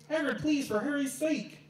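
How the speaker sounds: pleading for mercy in pain; desperate begging. Acted. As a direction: beg him to stop, pitch rising. Dumbledore begs in pain.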